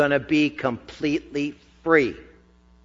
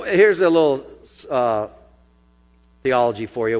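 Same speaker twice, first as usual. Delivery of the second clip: normal talking voice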